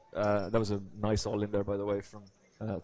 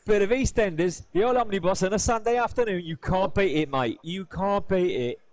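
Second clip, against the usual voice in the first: loud, high Cockney patter; big, showy Cockney rhyming slang; Cockney accent; 7 semitones higher, 5 dB louder than usual. Shown as a demonstration. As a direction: ham up an East End Cockney market voice. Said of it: "let's put this to the test".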